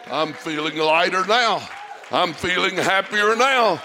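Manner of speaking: climbs from loud to a high, full shout; building to a shout. Acted. Pastor rejoicing before the church, building to a shout. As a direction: build it up till you shout it.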